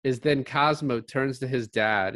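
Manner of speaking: normal talking voice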